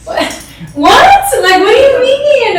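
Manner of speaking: stunned, can't believe what she just heard; shocked disbelief. Retold or imitated. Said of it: "and I'm like".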